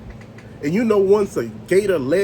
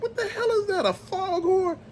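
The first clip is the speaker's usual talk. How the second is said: pitched way up, half laughing in shock; startled disbelief; 12 semitones higher, 4 dB quieter than usual. Retold or imitated. Recalling the scream in the woods, high startled disbelief. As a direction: squeak out the alarmed question. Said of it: "I'm like".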